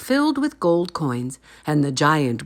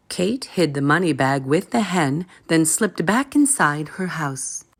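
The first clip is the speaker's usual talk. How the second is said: plain reading voice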